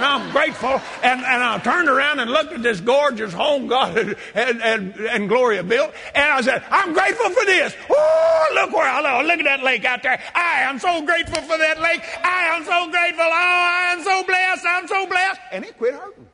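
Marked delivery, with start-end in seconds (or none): 0.0-0.8 hollering. Retold or imitated. 6.7-15.4 high, overjoyed exclamations; ecstatic praise. Retold or imitated. Retold joyous outburst on porch.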